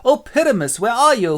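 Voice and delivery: high, loud lovelorn calling; hammy Thisbe. Acted. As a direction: call out as an overacting heroine. Thisbe calls her lover.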